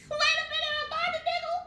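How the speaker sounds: asking through giggles; giggling disbelief. Real, not acted. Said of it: "I'm finna crack up".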